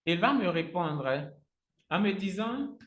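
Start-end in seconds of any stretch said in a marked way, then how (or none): none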